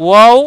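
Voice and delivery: second ওয়াও drawn out slowly; ওয়াও as a stretched "waaw", lips rounded; rounded-lip articulation. Shown as a demonstration. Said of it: "ঠোঁট গোল হবে".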